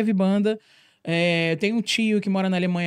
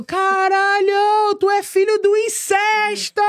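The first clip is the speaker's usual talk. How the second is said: much higher, slow, drawn-out taunting yell; mocking shout; 12 semitones higher, 5 dB louder, much slower than usual. Kid taunting the pretty boy before the whole school, in a high drawn-out yell. Yelling insult across school.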